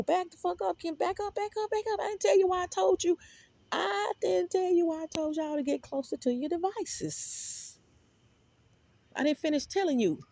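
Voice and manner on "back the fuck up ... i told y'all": pitched-up back up, back up; shooing her back, voice way up high; high sharp scolding. Real, not acted. Scolding Kim to back off, high-pitched.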